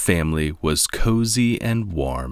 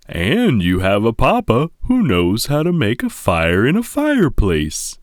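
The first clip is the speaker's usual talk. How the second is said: louder, hearty father bear; Papa voice; 5 semitones higher, 5 dB louder than usual. A narrator's reading. Father bear boasting to his cubs, hearty and loud. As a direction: boom it out like a proud papa bear.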